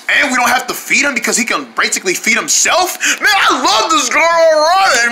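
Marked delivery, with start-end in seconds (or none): 3.2-5.1 delighted. Acted. Delighted eating pizza.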